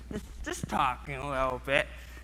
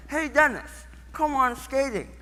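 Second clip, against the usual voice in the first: higher, lightly voiced eager girl; coaxing girl; 8 semitones higher than usual. Stacy calling Dennis to come on skating, higher and coaxing. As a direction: coax a friend to join in, pitched up. Girl coaxes him onto the rink.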